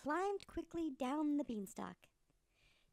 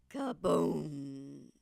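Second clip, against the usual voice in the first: crash boom; 9 semitones lower, 7 dB louder than usual. Acted. Giant crashes to ground.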